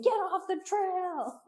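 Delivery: high, held-back mock yell; retold screaming. Friend just hears hikers screaming from afar, muted mock screaming. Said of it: "he just hears us screaming".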